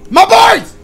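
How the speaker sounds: loud, high yell of surprise; a real startled holler; sudden shout. Real, not acted. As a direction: burst out at the screen. Sudden shout at game moment.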